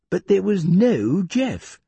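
usual speaking voice

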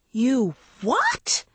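high, incredulous shriek; appalled outburst, shooting upward. A narrator's reading. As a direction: shriek WHAT in shock. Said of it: "Andrea shrieked".

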